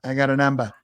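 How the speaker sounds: amused Boston movie quote, a bit low-key; Boston accent. Acted. Host echoing Goodwill Hunting line, Boston accent. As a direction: echo the line Boston-style, "numbah". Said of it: "Goodwill Hunting".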